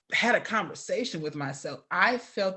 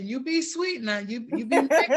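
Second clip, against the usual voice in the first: lightly imitated background admonishment, pitched up; Sister Boy calling out; 8 semitones higher, 5 dB louder than usual. Woman chiming in from the background, imitated fond admonishing. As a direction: playfully imitate an elder chiming in higher. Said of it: "Sister Boy in the background".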